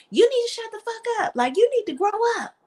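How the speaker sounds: high, fast mock scolding as [name]; imitating [name]. [name] telling [name] off, high mocking voice. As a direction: snap at her like an annoyed friend. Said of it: "[name] used to pop off on [name] and be like".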